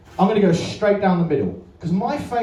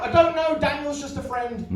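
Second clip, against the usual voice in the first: flustered mate; 8 semitones higher than usual. Retold or imitated. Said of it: "It's like".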